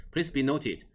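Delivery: usual speaking voice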